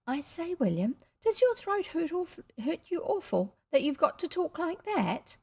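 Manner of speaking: put-on high, sympathetic child voice; anxious little girl. A narrator's reading. Girl asking about boy's sore throat, anxious. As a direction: fret over him girlishly. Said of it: "Her blue eyes were anxious and sympathetic".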